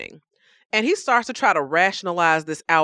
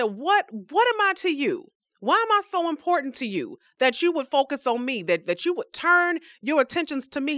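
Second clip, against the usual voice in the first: a fed-up man yelling at the sky; exasperated Job; 8 semitones higher than usual. Acted. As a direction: throw it up at heaven, fed up. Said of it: "is angry".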